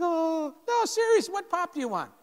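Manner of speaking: can't believe he's being asked; stunned disbelief. Retold or imitated. Scared freshman asked what pop he wants, high disbelief.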